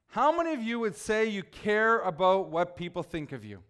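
ordinary delivery

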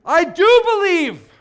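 high, loud, drawn-out cry of faith; passionate scream. Acted. Preacher acts the desperate father exclaiming, passionate scream. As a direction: scream it out slowly with full passion. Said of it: "there's some passion there".

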